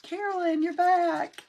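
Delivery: high, loud, delighted hello; excited greeting. Real, not acted. Greeting returning viewer Carolyn, high and delighted.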